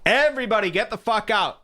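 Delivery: high-pitched mock yell; retold scream. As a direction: imitate screaming at a crowd, without full volume. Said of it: "I'm screaming at him".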